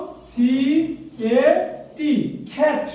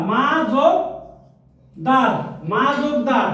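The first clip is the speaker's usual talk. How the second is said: split into two syllables. Shown as a demonstration. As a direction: spell out the two beats, মা, then দার, unhurried. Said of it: "দুইবার উচ্চারণ হয়েছে".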